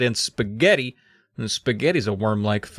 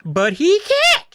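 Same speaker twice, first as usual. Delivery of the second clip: very high, loud, slow sputter; shrill, squeaky sputter; 5 dB louder, slower than usual. Shown as a demonstration. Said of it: "I'll try that again".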